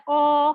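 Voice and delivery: slow heavy demo of او; heavy o sound. Shown as a demonstration. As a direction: show او as a thick, heavy vowel. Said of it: "تو اس کے اندر کیسے ہوتا ہے".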